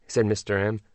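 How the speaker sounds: usual speaking voice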